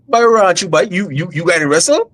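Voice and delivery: high, loud Guyanese parent voice; imitating a strict Guyanese parent; Guyanese accent. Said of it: "in the Guyanese accent".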